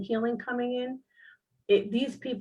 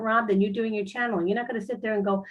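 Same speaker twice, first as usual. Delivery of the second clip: ordinary delivery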